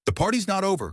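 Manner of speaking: high, rising protest. A narrator's reading. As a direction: push back, voice climbing high. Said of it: "Clara protested, her voice rising".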